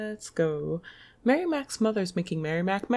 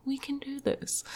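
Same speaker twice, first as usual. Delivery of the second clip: soft, higher self-encouragement; pep talk; 6 semitones higher, 5 dB quieter than usual. Real, not acted. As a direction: coax yourself gently.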